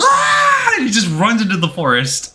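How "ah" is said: high, loud fleeing scream; mock scream. Sokolov screaming as he runs off, high loud yelp. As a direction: yelp as you bolt for the trees. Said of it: "Sokolov's just".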